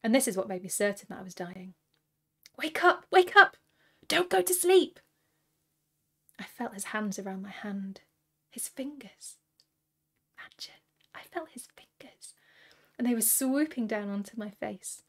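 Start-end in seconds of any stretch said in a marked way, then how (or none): 2.6-5.0 loud, high-pitched yell to rouse someone; frantic wake-up shout; urgent shouting. A narrator's reading. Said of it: "shouting like the men had shouted before". 8.5-12.4 very soft, half-whispered; hushed wonder. A narrator's reading. Narrator marvels at remembered touch.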